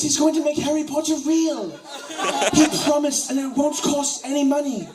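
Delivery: put-on high, loud childish pleading voice; hammed-up posh child begging mommy; British college student impression. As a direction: whine at mommy like a spoiled boy. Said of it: "my impression of a British college student".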